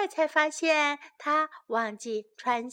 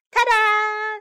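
high, loud, sustained fanfare; triumphant announcement; 8 semitones higher, 6 dB louder than usual. A narrator's reading. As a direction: show off with a high ringing call. Child shows off being dressed.

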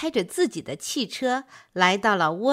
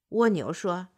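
ordinary delivery

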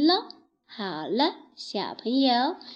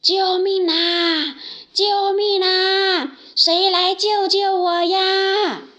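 higher, louder, slow drawn-out cries; calling for help; 8 semitones higher, 6 dB louder, slower than usual. Acted. Trapped lion shouts for someone to save him. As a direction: shout desperately for rescue. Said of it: "大声喊着".

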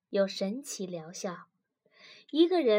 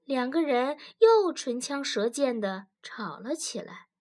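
ordinary delivery

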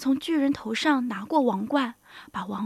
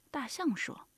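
plain reading voice

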